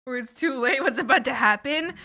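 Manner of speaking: ordinary talk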